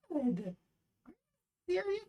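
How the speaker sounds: high, cracking rise of disbelief; squeaky disbelief. Real, not acted. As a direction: squeak it, can't believe it. Reacting to ban news.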